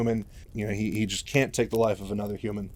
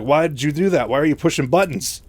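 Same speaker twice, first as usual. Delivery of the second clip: raised, louder exasperated questions; mock scolding; 4 semitones higher, 6 dB louder than usual. [name] scolds Mike over the buttons, exasperated. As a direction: demand an explanation, lightly acted.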